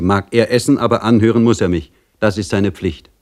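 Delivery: ordinary delivery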